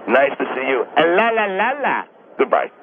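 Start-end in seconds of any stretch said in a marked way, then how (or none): 1.0-2.0 high-pitched la-la call; la-la farewell trill. Acted. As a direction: sing out a string of high la-las as a farewell. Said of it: "Rudy's method and way of saying goodbye".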